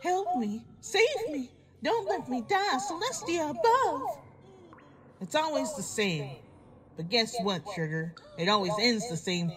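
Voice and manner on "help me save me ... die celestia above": higher, whiny mock cries for help; mocking pleas. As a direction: parody a victim's begging. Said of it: "The pleas and cries from Sarah".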